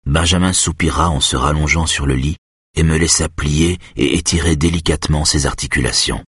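ordinary delivery

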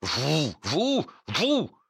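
high choked accusation, climbing; strangled yelp. A narrator's reading. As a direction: squeal it back as if choking. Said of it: "glapissant et s'étranglant". (glapissement accusateur, étranglé)

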